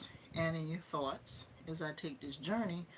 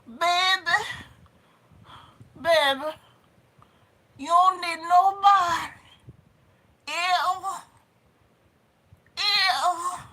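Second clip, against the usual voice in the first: very high, loud grandma impression; hammed-up grandmother voice, pitched way up and blasting; grandmama; 13 semitones higher, 13 dB louder, slower than usual. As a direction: holler it like a Southern grandma, in short bursts. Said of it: "put on my grandma glasses".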